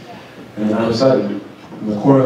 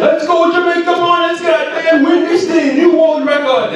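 high, fired-up rallying cry; rally the team before the final; hype shout; 3 dB louder than usual. Retold or imitated. Bolt hyping team in call room.

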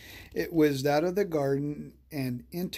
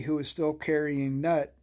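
ordinary delivery

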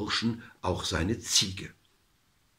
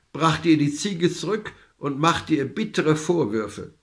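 ordinary delivery

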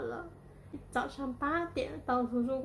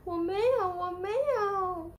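tearful protest; 6 semitones higher, 4 dB louder than usual. A narrator's reading. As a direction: wail it like an upset child in a story. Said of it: "小华哭着说".

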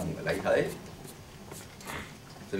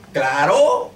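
demon's answer; 6 semitones higher, 15 dB louder than usual. Retold or imitated. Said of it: "Me dijo".

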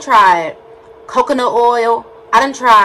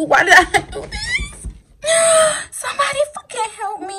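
panicked wail. Real, not acted. Vlogger begs for help with stuck lashes, wailing. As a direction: cry out helplessly, voice climbing very high.

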